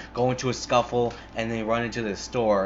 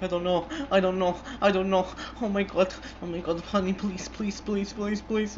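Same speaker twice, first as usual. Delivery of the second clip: high-pitched, repetitive mock pleading; imitated crying girlfriend; 10 semitones higher than usual. Girlfriend begging her angry boyfriend, high mock-tearful pleading. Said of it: "the girl's fucking crying".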